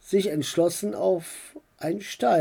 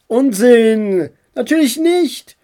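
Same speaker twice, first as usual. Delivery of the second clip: put-on loud, high boyish protest; emphatic, raised-voice rebuttal; indignant denial; 6 semitones higher, 8 dB louder than usual. A narrator's reading. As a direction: protest hotly at being teased. Boy indignantly rejects the tease.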